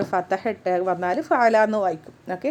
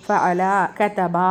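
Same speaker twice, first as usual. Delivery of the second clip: ജലസ, കതബ drawn out very slowly; teacher reciting example verbs very slowly; slow recitation; 2 semitones lower, much slower than usual. Shown as a demonstration. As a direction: spell out ജലസ and കതബ at half speed.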